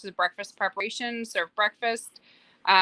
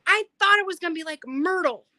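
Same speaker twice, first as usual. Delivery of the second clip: higher, lightly voiced young child; imitating a little boy; 9 semitones higher, 4 dB louder than usual. Little brother comparing the sea turtle to his pet, lightly voiced child. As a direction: say it like a slightly let-down small kid. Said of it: "and he goes".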